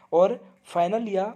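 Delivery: ordinary delivery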